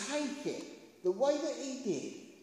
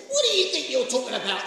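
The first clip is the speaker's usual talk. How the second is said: loud, fast acted outburst; angry denial; 9 dB louder, much faster than usual. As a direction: snap back loudly in angry denial. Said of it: "swearing and swearing and swearing".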